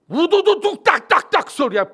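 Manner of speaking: loud rattling crack sounds; cracking bones noise. Retold or imitated. Preacher imitating bones snapping, loud rattling crack.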